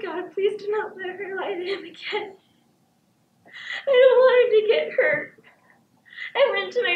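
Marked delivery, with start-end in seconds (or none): 0.0-2.4 soft, weepy little prayer; tearful prayer. Acted. Child prays while crying. 3.9-5.4 louder, higher sobbing plea; crying. Acted. Child crying about fighting parents.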